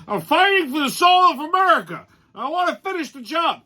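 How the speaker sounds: lightly voiced mock Biden, up high; quoting Biden. Mocking the president's video line, lightly voiced mock Biden. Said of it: "and I quote".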